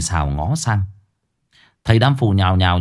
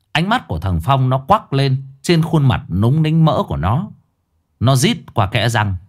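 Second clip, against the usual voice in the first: usual speaking voice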